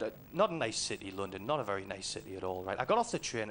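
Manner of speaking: ordinary delivery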